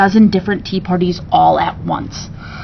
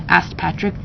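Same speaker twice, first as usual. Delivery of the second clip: usual speaking voice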